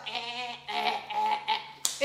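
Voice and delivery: loud retching eh sounds; mock gagging. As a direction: gag out loud for effect. Gagging after bad sip.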